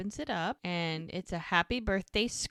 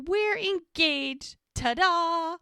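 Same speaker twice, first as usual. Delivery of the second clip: high, drawn-out showy reveal; triumphant announcement; 10 semitones higher, 4 dB louder, slower than usual. Retold or imitated. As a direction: sing it out like a grand reveal. Acting out showy engagement announcement.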